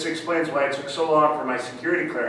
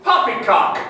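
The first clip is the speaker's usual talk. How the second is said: put-on raised British-accented exclamation; 6 semitones higher, 4 dB louder than usual. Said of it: "don't know if that's a British phrase".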